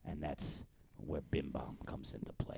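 breathy hushed gangster aside; conspiratorial whisper. Acted. Whispered secret to a fellow gangster.

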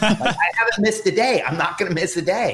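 high, laughing insistence; insistent. Retold or imitated. Telling his wife he won't miss yoga, laughing and insistent. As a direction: laugh while refusing to skip a day.

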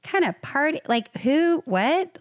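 high, incredulous questioning; mock bewilderment, pitched well up; baffled disbelief. Couple reacting to mother-in-law's grand plans, high and baffled.

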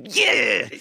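high triumphant whoop; mock victory yell; over-excited celebration. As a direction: cheer as if you just scored. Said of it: "you kiss someone and then you go".

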